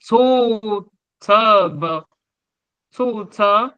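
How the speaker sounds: To, Tub with rising tone. Shown as a demonstration. Teacher demonstrates the rising tone. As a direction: lift each syllable upward. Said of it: "c'est le ton montant".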